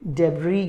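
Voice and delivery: डेब्री ending in "ee", s silent; correct pronunciation. Shown as a demonstration. Teacher asking learners to remember it, डेब्री with silent s. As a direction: say डेब्री low, as "deb-ree". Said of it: "correct pronunciation याद रखिएगा".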